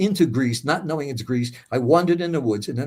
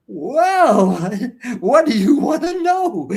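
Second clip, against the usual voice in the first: laughing surrender; 10 semitones higher, 6 dB louder, much slower than usual. Retold or imitated. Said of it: "It's like".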